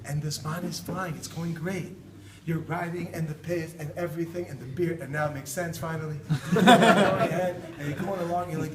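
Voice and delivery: ordinary talk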